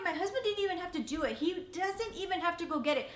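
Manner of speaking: ordinary talk, nothing marked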